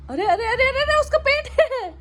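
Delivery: high alarmed "arre arre" to the pet; startled cries. Real, not acted. Owner startled as the dog digs at her belly, high alarmed cries.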